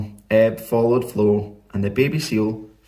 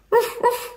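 high-pitched little dog yap; sharp, squeaky ruff; dog bark. A narrator's reading. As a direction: bark like an excited small dog. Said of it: "barked Ebb".

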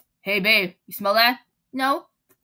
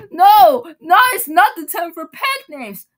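loud, high, hammed-up protest; mock tearful protest, loud and high; 6 semitones higher, 10 dB louder, slower than usual. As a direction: shoot down the pet name in a loud fake sob. Protesting, thinks baby is pet name.

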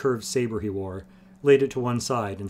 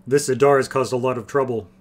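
rumbling; 3 semitones higher, 8 dB louder than usual. Acted. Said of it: "Barrick rumbled".

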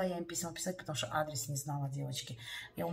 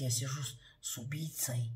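whispered in remembered fright; scared whisper; 4 semitones lower than usual. Retold or imitated. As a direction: breathe it out, still scared. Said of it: "мне так страшно стало".

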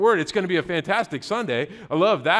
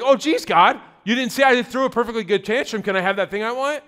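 higher-pitched whiny complaint to God; hammed-up aggrieved protest; mock upset; 4 semitones higher, 4 dB louder than usual. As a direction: play up a petty tantrum, starting high and loud. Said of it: "We get all upset".

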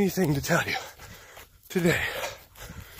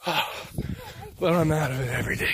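ordinary delivery

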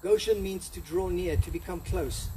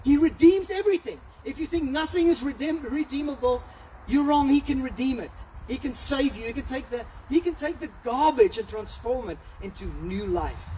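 higher, louder, rising to a passionate peak; fiery preaching; 6 semitones higher, 3 dB louder than usual. Acted. Preacher rousing the church about God who redeems, fervent. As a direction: preach it out full force to the congregation.

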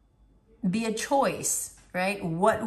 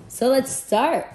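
excited; 7 semitones higher, 8 dB louder than usual. Real, not acted. Opens the card reading.

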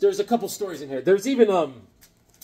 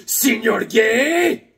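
slow, drawn-out mock call to the teacher, higher and louder; mocking classroom holler; teasing call-out; 7 semitones higher, 7 dB louder, much slower than usual. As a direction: stretch out the name to needle him. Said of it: "I used to go".

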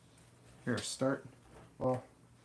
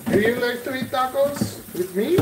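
put-on Mexican accent; 5 semitones higher, 11 dB louder than usual. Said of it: "Mexican accent".

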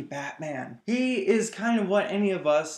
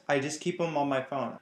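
usual speaking voice

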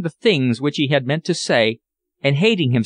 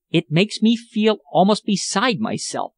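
higher-pitched, understated anger; passionate exclamation; 6 semitones higher than usual. A narrator's reading. Young woman beside herself over her helplessness, mildly angry exclamation. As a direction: exclaim with a touch of angry frustration. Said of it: "she exclaimed".

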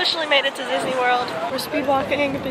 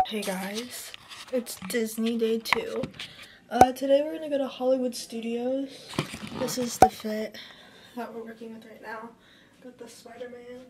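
quiet morning voice; 2 semitones lower, 12 dB quieter than usual. Real, not acted. Early morning quiet intro.

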